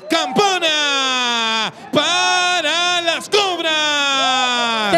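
long stretched shout, high and slow; drawn-out victory call. Real, not acted. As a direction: hold the call like a goal cry. Announcing the winning point.

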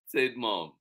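usual speaking voice

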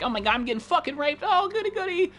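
high squeaky sarcastic victim voice; mock a pushover who enjoys being attacked; mock meek victim. Streamer mocking a passive victim in game, high squeaky mock. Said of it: "I'm not gonna be like".

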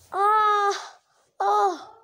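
high, short pre-sneeze gasps; two high "ah"s working up to a sneeze; sneeze build-up. Acted. Dragon about to sneeze at the villagers, high "ah" build-up. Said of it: "The noise erupted from the dragon".

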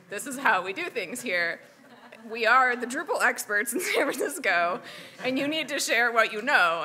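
retold firm insistence over audience laughter; imitating insistent boss. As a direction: lay down the law as the boss, playfully. Retelling boss insisting on talks.

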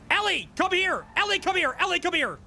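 high-pitched, insistent summons; calling out. Real, not acted. Boss calling Ellie over, urgently calling out. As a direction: call her over, each phrase falling from high.

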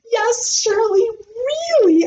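put-on high, sing-song kid voice; proud sing-song. Junie B telling Shirley it's true, proud sing-song. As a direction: lilt it proudly.

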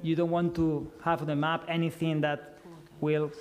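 usual speaking voice

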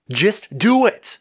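high, drawn-out command; insistent, slow command; urgent order. A narrator's reading. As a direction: order them with each word stretched. Blue orders the others mid-battle.

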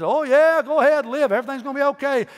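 high mocking sing-song reassurance; mock the king with fake cheer; sarcastic. Micaiah mocking Ahab about the battle, high and sarcastic. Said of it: "he did it in a sarcastic way".

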